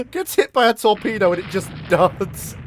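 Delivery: high, loud, laughing outrage; laughing disbelief. Real, not acted. Gamer laughing as a torpedo duds, laughing outrage. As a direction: crack up in disbelief.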